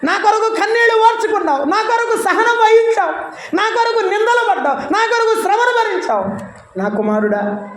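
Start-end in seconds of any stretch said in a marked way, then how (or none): 0.1-6.6 preaching at full force. Acted. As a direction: preach it out to the congregation, raised and ringing. God's words, proclaimed high. (బిగ్గరగా, ఎత్తైన స్వరంతో ప్రసంగం)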